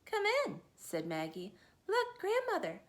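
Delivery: put-on bright little girl calling; Maggie. Maggie calls grandmother to the door.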